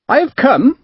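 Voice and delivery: high, friendly Devonshire naval captain; friendly Devonshire accent. A narrator's reading. Captain politely announcing himself at the merchant's house, friendly Devonshire accent. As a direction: greet him breezily in a Devonshire accent. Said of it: "a friendly voice that had a distinct Devonshire accent".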